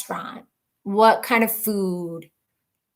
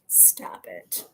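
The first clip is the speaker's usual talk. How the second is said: whisper; 18 dB quieter than usual. Real, not acted. Reacting to flower joke.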